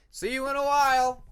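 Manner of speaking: high, loud call into the trees. Acted. Cliff calling back to Peacemaker as he leaves, loud and high. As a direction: call it out as you dive away.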